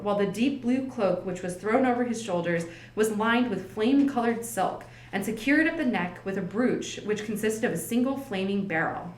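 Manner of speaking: usual speaking voice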